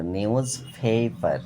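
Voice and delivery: নিউজ পেপার with puffed p, "pheypar"; corrected pronunciation. Shown as a demonstration. Teacher giving pupils the correct form, নিউজ পেপার as "niuj pheypar". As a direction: glide the first vowel of পেপার, "phey". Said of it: "এটা হয় সঠিক উচ্চারণ".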